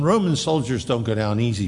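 ordinary talk

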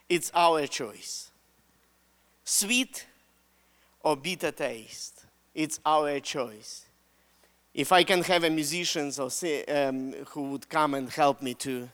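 soft, low, hushed sermon close; solemn appeal. Real, not acted. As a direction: bring the room down gently and gravely. Solemn close, calls up musicians.

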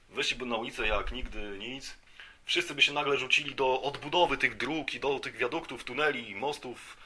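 usual speaking voice

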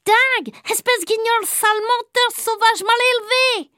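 put-on high, happy child voice; delighted. New boy happily repeats the teacher's words. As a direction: rattle them off gleefully. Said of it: "plus en plus content".

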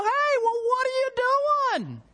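high-pitched, drawn-out outraged cry; outraged confrontation. Acted. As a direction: act out yelling at a friend in disbelief. Confronting friend who shot the dog.

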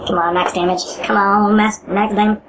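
usual speaking voice

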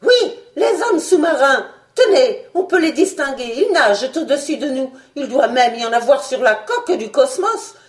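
high, loud urgent report; agitated alarm. Acted. The doctor warns of the sea-men on the hull, agitated and loud. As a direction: rush the warning out.

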